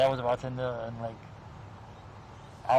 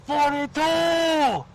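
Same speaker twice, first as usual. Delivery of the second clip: high called-out number across the lunchroom; yelled number; 13 semitones higher, 5 dB louder, much slower than usual. Retold or imitated. Prisoner yells a number across the lunchroom, high shout. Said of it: "someone yells".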